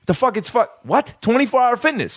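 high-pitched mock indignation; retold losing it. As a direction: act out an incredulous outburst at the gym staff. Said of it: "and he lost it".

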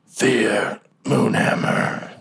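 slow, strained last words of a dying assassin; dying gasp. Acted. Assassin with the blade in its spine, dying gasp. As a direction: drag it out on a dying breath. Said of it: "with its dying gasp yells".

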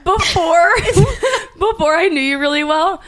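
high, laughing through the words. Real, not acted. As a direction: start the story mid-laugh. Laughing while starting story.

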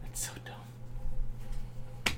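slow, hushed whisper; slow whispered dismay; whispered disbelief. Real, not acted. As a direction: drag it out under the breath, unvoiced. Disgusted aside about booking decision.

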